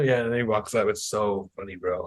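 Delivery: normal talking voice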